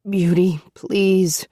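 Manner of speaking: plain reading voice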